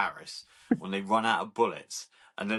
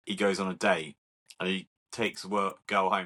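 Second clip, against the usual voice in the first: ordinary talk, nothing marked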